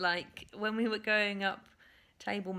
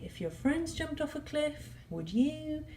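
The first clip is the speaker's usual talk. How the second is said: a hint of a scolding mum, pitched higher; mum's warning; 8 semitones higher than usual. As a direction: lightly mimic every mother's old warning. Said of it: "that phrase that all mums say".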